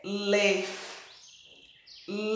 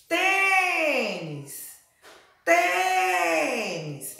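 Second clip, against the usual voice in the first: "tênis" called with a long, high first syllable, falling away; calling out the word; 7 semitones higher, much slower than usual. Shown as a demonstration. Teacher calls out "tênis" so pupils hear the strong syllable, calling out. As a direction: call "tênis" stretching TÊ high. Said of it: "Chamar essa palavra".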